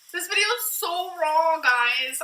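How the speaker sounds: high, loud mock vlogger voice; mocking YouTuber catchphrase. Mocking the catchphrase viewers always use. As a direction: ham up the vlogger cliche. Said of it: "what's that thing you guys always say?".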